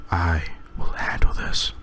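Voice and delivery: slow, soft breathy whisper; faint whisper. Acted. Wounded father sending them away, faint whisper. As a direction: barely get the words out.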